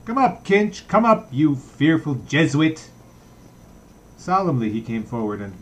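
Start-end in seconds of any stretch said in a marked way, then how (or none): 0.0-2.9 loud, much higher call up the stairs; coarse call. Acted. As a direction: bellow it coarsely down the dark stairwell. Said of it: "called up coarsely".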